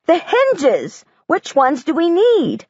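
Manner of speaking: high, eager and insistent; urgent and thrilled; excited impatience. A narrator's reading. Excited girl in store.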